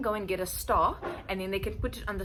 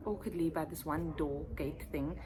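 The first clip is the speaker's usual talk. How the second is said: ordinary delivery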